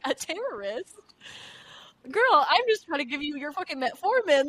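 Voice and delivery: disbelieving nurse, half laughing; incredulous. Retold or imitated. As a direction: scoff at the accusation. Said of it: "I was like".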